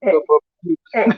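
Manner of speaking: ordinary talk, nothing marked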